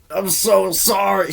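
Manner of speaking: high, slow sorry voice; apologetic woman voice. Retold or imitated. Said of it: "She felt so bad".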